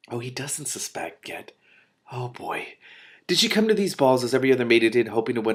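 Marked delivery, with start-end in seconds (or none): 0.1-2.7 soft slow whisper; hushed knowing reaction; whispered aside. Real, not acted. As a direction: murmur it knowingly.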